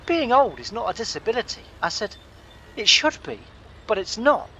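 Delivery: high, exasperated telling-off; retold indignant scolding. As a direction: lay down the law, fed up. Lecturing the old man.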